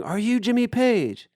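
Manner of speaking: high, slow mock holler; imitated calling out. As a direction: keep hailing the boat, drawn out. Edwin calls to boat again.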